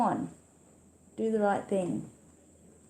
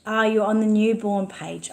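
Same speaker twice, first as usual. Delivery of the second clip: ordinary delivery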